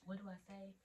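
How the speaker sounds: very soft retold question; hushed cluelessness; helpless aside. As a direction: ask it under your breath, at a loss. Retold helpless whisper to instructor.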